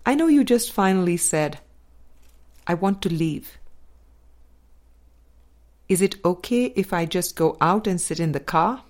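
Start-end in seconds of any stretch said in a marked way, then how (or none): none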